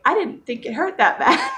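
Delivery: high retold near-tearful voice, laughing; voice cracking, tearful. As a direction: imitate tearing up, half laughing. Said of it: "cracking up, tearing up".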